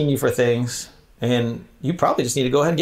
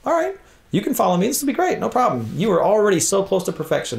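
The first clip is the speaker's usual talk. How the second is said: high, sugary mock welcome; mock sweet; 7 semitones higher than usual. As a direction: gush falsely like an eager recruiter. Mock overly sweet invitation.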